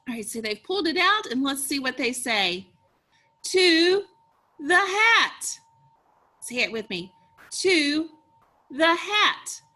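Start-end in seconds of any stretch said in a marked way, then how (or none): 3.4-5.6 high, loud exclamation; excited. Shown as a demonstration. Reads book's exclamation for children. 7.6-9.6 raised, enthusiastic read-aloud; excited. Shown as a demonstration. Repeats exclamation with the children.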